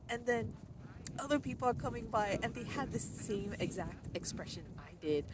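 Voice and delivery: ordinary talk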